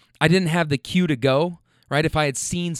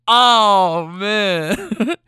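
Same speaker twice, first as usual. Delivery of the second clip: laughing; 6 semitones higher, 5 dB louder than usual. Retold or imitated. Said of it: "The dude bursts out laughing again".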